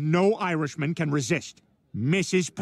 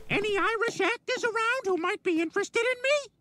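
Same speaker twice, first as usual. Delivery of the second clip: high flirty toy voice. Pretend. Toy voice asking for actors.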